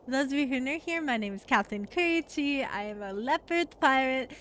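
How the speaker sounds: ordinary delivery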